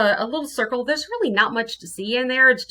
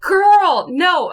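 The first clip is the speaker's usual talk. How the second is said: high, loud sassy objection; emphatic protest; 9 semitones higher, 8 dB louder than usual. Acted. Protesting to a co-host who chickened out, emphatic. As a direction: push back loudly, drawing out the girl.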